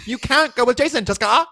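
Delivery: high mocking mean-girl voice; catty teen girl. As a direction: tease like a catty high schooler. Mock teen girl drama.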